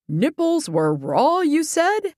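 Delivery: slow question climbing very high at the end; father's drawn-out, incredulous question. A narrator's reading. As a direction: query it slowly, ending way up high. Father questions daughter, in character.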